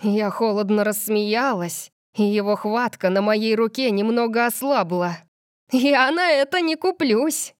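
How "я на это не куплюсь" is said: raised, higher-pitched defiance; defiant retort. A narrator's reading. Heroine refuses to fall for his taunt, defiant. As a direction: throw it back at him firmly. Heroine defies him after cold laugh.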